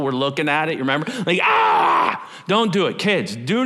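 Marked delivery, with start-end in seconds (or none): 1.4-2.3 sudden loud cry at the glare; pained yelp. Retold or imitated. Pastor acting out staring at the eclipse, sharp yelp. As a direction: yelp as if the sun burns your eyes.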